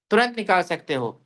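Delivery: ordinary delivery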